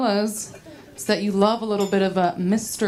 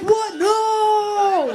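high squeaky shout of disbelief; shocked; 12 semitones higher, 3 dB louder than usual. Retold or imitated. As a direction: shriek it in mock horror. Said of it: "He's like".

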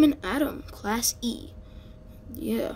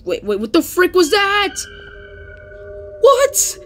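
much higher and louder, incredulous; shocked disbelief; 10 semitones higher, 6 dB louder than usual. Real, not acted. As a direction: blurt it out in stunned amazement. Shocked at bird falling.

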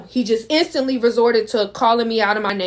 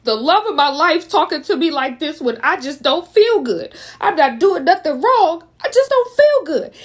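rising upset pitch, high and louder; 6 semitones higher, 3 dB louder than usual. Retold or imitated. Retelling to viewers how he hurt her, high and louder. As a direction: voice climbing higher in outraged disbelief. Recalling boyfriend's unfair accusations.

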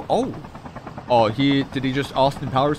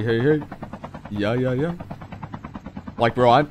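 ordinary delivery

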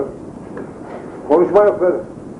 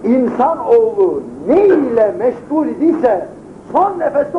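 preaching; 6 semitones higher than usual. Acted. Preaching moral lesson.

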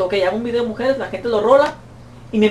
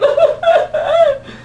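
very high squealing laugh; shrieking giggle at the absurdity; squealing laughter. Real, not acted. Laughs at his rant.

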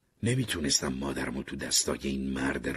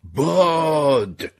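long drawn-out, high, very loud yell; shout; 12 semitones higher, 12 dB louder, much slower than usual. A narrator's reading. Someone warning the crowd amid the fire, shouted long. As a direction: bellow one word, stretched right out. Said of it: "یکی داد زد".

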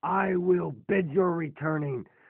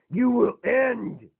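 slow threat; 6 semitones higher, slower than usual. Acted. Robot threatens Galvatronus.

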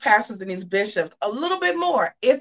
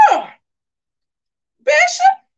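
high, loud drawn-out oh; hammed-up shocked oh, Bishop; mock surprise; 6 dB louder, slower than usual. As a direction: gasp it out like you just found the video. Retold surprised reaction.

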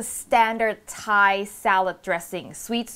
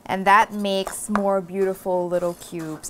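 ordinary delivery

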